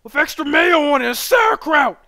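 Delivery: high, loud craving; mock hungry yelling. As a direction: shout your order like you're starving. Craving a sandwich.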